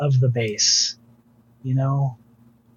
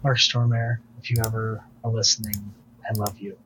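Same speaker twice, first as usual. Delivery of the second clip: softer, lower, heartfelt aside; tender direct address; 4 semitones lower, 3 dB quieter, faster than usual. Real, not acted. Fan to his bass-player hero, a tender direct address. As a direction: speak softly and low, as if to an idol.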